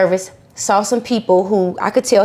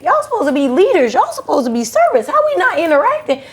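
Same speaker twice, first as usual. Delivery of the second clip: pitched up high, calling them out in disbelief; indignant, scolding the people around her; exasperated rebuke; 9 semitones higher than usual. Retold or imitated. Retelling how she scolded the church leaders, exasperated and pitched high.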